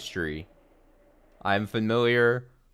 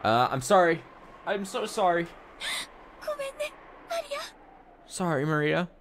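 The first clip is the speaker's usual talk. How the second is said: pleading apology; 9 semitones higher than usual. Acted. Rosa apologizing to her daughter.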